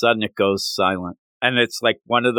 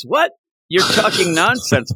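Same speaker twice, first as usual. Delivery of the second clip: high-pitched mock outburst; indignant disbelief; 7 semitones higher than usual. Podcaster playing Power rejecting the news, indignant. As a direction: scoff in high-voiced imitation of outrage.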